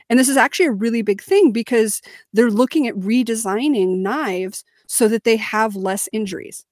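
ordinary talk, nothing marked

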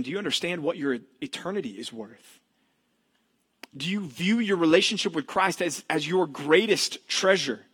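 ordinary delivery

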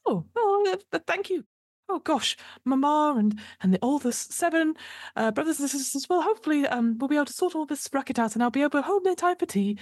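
high, teary little voice; a nervous bespectacled soul near tears with relief; timid grateful man. Acted. Poor chap thanking the noble, high teary grateful voice. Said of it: "He goes".

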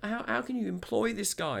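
high-pitched imitation of an agitated customer; really agitated. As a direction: mimic her frantic accusing. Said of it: "she's getting really agitated now".

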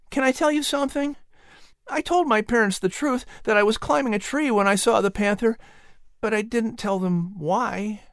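put-on high boy's voice, hesitant; boy about to confess; confiding Patrick. A narrator's reading.

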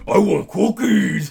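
loud, slow, high monster growl; Cookie Monster voice. Pretend. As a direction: bellow it like a hungry monster.

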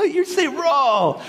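high-pitched "rural" as one syllable, roll; mimicking slurred rural. Husband teasing wife in the car, slurred "rural". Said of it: "the way my wife says rural".

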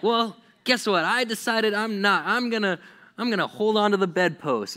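high, loud mock defiance; rebellious kid. Kid refusing to leave bed for school, mock defiant. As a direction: dig your heels in, hammed-up. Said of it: "you were a rebellious kid".

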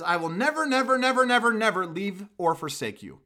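second "never, never" jumps high and loud; repeated emphatic never. Shown as a demonstration. Preacher giving God's promise to the congregation with rising stress on never. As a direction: stack up the "never"s and let them build. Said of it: "so much emphasis in this passage".

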